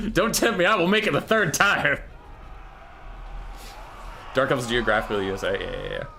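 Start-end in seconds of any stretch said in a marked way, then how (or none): 0.1-2.0 high, emphatic hammed-up threat; mock threat. Caster threatening to repeat his bad bit, mock threat. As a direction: threaten it with over-the-top bravado.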